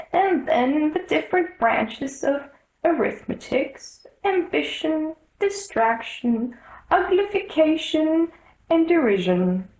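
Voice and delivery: lifted, plaintive Mock Turtle voice listing odd school subjects; mournful Mock Turtle. The Mock Turtle sadly lists his lessons to Alice, high and plaintive. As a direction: pitch it up and let it sound forlorn.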